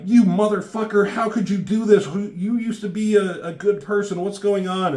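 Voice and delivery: high-pitched, outraged confrontation; mock tirade, pitch kept high; acted angry yelling. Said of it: "she kind of is yelling at him".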